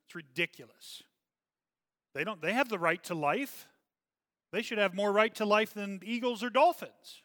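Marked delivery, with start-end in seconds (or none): 2.1-7.2 fired up. Real, not acted. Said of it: "Now I'm getting all fired up".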